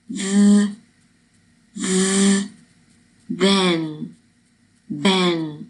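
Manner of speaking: long buzzing TH, then THEN twice; isolated TH buzz, then the word THEN, slowly separated; voiced TH sound. Shown as a demonstration. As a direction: demonstrate voiced z-like TH, held, with vocal cords vibrating. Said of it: "我们需要震动声带".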